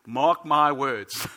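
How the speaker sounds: slow, loud, heavy father's warning; father's stern warning. Retold or imitated. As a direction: boom it out like a stern dad. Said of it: "I've got my father's voice in, like, in my head".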